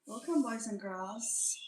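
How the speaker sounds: ordinary talk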